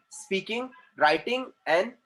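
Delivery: ordinary delivery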